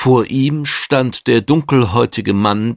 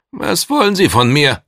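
wary, higher and louder demand; misstrauisch; 7 semitones higher, 5 dB louder than usual. A narrator's reading. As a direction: challenge the visitor with suspicion. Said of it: "fragte der N-E-I-Agent misstrauisch". (misstrauisch fordernd)

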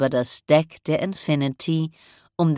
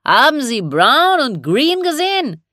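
high, loud shouted question; raised captain's holler; calling up; 11 semitones higher, 6 dB louder than usual. Acted. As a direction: call up to a crewman aloft. Captain calls up to man aloft.

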